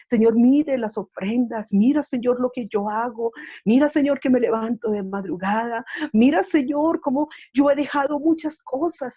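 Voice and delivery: voicing a believer begging God for recognition; pleading prayer.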